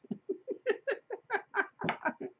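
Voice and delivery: high delighted laugh; real laughter. Real, not acted. Teller laughing at boy.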